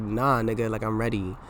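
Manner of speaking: ordinary talk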